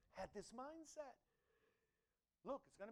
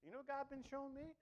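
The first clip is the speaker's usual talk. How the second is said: ordinary delivery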